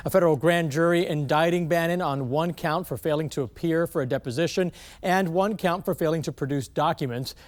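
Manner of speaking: usual speaking voice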